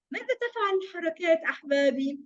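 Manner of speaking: usual speaking voice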